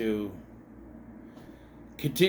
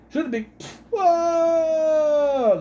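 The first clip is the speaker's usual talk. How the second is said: high re-enacted movie line into a long wail; mock cry; 13 semitones higher, 5 dB louder, much slower than usual. Re-enacting the pig scene, high drawn-out mock cry. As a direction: call it out, then stretch "Whoa" high and loud.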